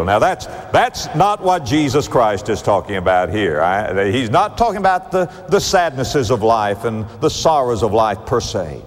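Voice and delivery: normal talking voice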